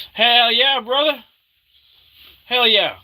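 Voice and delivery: high loud hell yeah; hyped shout. Acted. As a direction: yell it like a hype bro.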